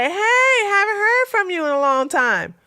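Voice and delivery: high, whiny mock of his text; mock innocent man. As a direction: play innocent, as if nothing happened. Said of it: "act like nothing happened".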